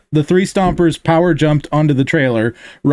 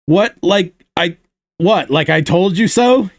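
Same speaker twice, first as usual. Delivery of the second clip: scoffing; 3 semitones higher than usual. Acted. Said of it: "he scoffed".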